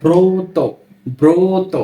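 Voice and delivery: ব্রত said as "broto", o after b; ব্রত with a clear o-sound; model reading. Shown as a demonstration. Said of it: "এরূপভাবেই উচ্চারিত হয়ে থাকবে". (ব্রত শব্দে ও-কারান্ত উচ্চারণ)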